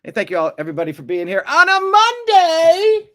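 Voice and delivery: thanking viewers in a hammed-up announcer voice; mock host voice. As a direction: ham it up like a game show host.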